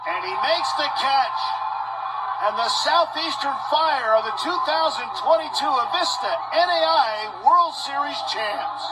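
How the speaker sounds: real excitement at full voice; jubilant championship call. Real, not acted. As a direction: let it soar as the title is won. Calling the championship-winning catch.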